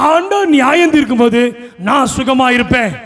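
ordinary talk

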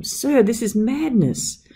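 high, slow protest; alarmed shout. A narrator's reading. Servant protesting to master in the living room, high slow shout. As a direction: plead high and drawn out. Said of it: "I shouted".

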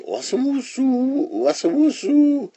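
high, swishing snake slither; slithering sound. Acted. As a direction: imitate a snake sliding along, high and sibilant. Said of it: "who came slithering".

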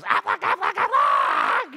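high, loud squawking Aflac duck call; honking duck cry, high and loud. Retold or imitated. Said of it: "This duck goes".

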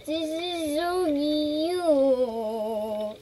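very slow, stretched-out gush; drawn-out cooing. Real, not acted. Player adoring the horse in front of her, long drawn-out coo. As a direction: stretch every word in adoration.

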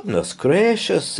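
high, slow, startled exclamation; exclaiming. A narrator's reading. Mrs Bird startled at the door, exclaiming. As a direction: gasp it out in surprise. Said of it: "exclaimed Missus Bird".

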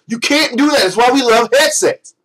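ordinary delivery

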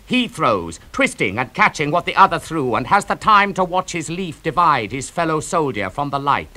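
plain reading voice

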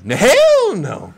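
high, loud, drawn-out refusal; emphatic denial. Real, not acted. Correcting a viewer about her favorite, loud indignant denial. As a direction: belt it out in indignation.

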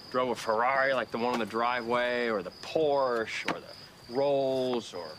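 slowing to a crawl, savoring each item; slow, dawning idea. Acted. Schemer gets inspired by the cars, talking very slowly. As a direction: drag out the list as inspiration strikes. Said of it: "Why are you talking so slowly?".

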